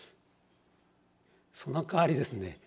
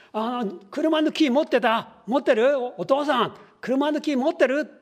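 high, loud nagging wife checking the car key; 12 semitones higher, 9 dB louder than usual. Acted. Wife calling to her husband about the car key, high and loud. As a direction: pester the husband about the key, much higher.

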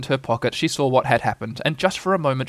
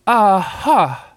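high, louder little "Aha"; Piglet's shaken attempt at "aha"; feeble attempt; 8 semitones higher, 5 dB louder than usual. A narrator's reading. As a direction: squeak out a poor "aha" after a fright. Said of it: "as well as he could after his terrifying journey".

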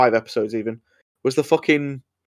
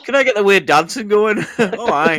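mock Scottish; 8 semitones higher, 3 dB louder than usual. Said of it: "terrible Scottish accents".

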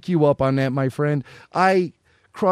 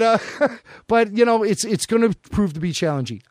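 ordinary delivery

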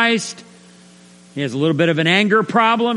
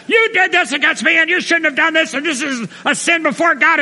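full indictment; 10 semitones higher than usual. Acted. Preacher mimes indicting a brother who sinned, accusing. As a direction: lay into him with a shrill-pitched accusation. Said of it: "fully indict him".

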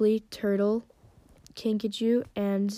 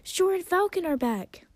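high-pitched, excited call; high, drawn-out announcement shouted to friends; yelling over shoulder; 10 semitones higher, 3 dB louder than usual. A narrator's reading. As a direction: call the others over, thrilled. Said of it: "Fate Speaker yelled over her shoulder".

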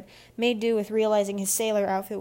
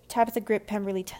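usual speaking voice

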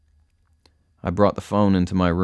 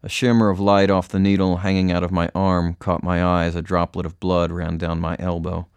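plain reading voice

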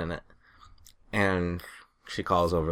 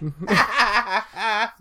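laughter; 14 semitones higher, 5 dB louder than usual. Real, not acted. Laughing after story.

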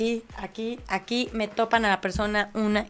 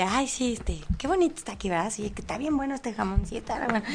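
softer, casual small-talk voice; 8 dB quieter than usual. Retold or imitated. Softly making small talk with the pregnant woman at the deli. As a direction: chat her up softly and innocently. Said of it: "Le sonreí así de".

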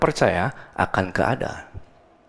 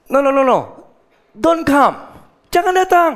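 urgent pleading; 7 semitones higher, 3 dB louder than usual. Acted. Centurion's servant pleading with Jesus.

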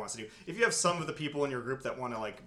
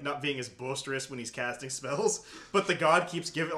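usual speaking voice

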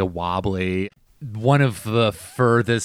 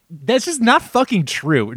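usual speaking voice

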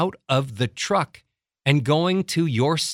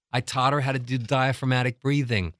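ordinary delivery

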